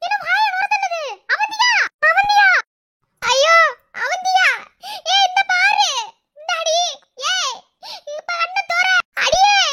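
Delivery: high-pitched, panicked calls; alarmed calling. Acted. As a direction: try urgently to rouse someone collapsed. Finds girl foaming, tries rousing her. (பதற்றத்துடன் உரத்த அழைப்பு)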